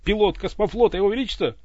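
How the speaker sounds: loud, high shout. Acted. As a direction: announce yourself at full voice. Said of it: "заорал Иван". (Иван кричит голосу своё имя)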